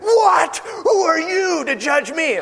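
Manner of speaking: put-on high, slow whiny kid yelling back; hammed-up childish outrage, up high; indignant kid. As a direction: play the bratty kid shouting at mom. Kid talking back to mom.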